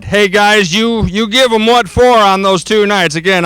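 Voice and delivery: ordinary delivery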